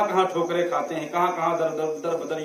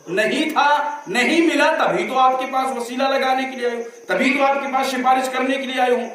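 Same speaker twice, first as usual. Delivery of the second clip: higher and fast, pressing the point; fervent preaching; 7 semitones higher than usual. Acted. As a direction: drive the argument home quickly. Preacher pressing the woman's argument. (جوش سے، تیز اور اونچی آواز میں دلیل)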